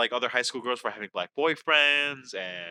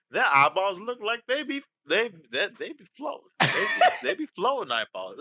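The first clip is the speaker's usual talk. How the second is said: Southern accent; 5 semitones higher than usual. Shown as a demonstration. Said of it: "because I was doing Southern".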